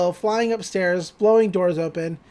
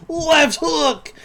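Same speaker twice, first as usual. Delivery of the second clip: mock shout; 9 semitones higher, 3 dB louder than usual. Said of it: "Stan just screams".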